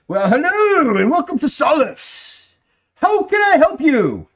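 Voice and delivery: high, loud, slow echoing welcome; booming shopkeeper greeting. Acted. Unseen shopkeeper greets adventurers, high and loud. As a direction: sing out a grand welcome from nowhere.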